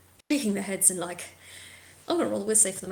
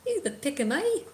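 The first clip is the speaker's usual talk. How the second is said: put-on raised, haughty British father scolding his boys; spectral head's accent; 4 semitones higher than usual. Disembodied father head berating his three sons, haughty. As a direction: sneer down at them like a disappointed parent. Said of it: "you hear this spectral, uh, head just".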